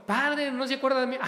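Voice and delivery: high, loud, quick mock-effusive call; effusive greeting. Parishioner greets the priest who forgot them, imitated effusiveness. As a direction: imitate an over-eager parishioner hailing the priest. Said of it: "bien efusivamente".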